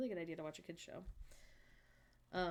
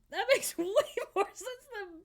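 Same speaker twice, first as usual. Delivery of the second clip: laughing; 14 semitones higher, 7 dB louder than usual. Real, not acted. Laughing at the viewer's mishearing.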